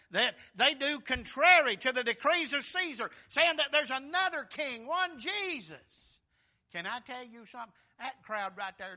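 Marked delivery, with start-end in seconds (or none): 0.1-6.2 fiery preaching. Real, not acted. Preaching climax on the accusation.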